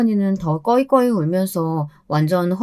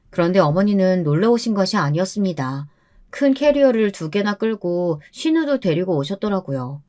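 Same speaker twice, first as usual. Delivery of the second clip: ordinary delivery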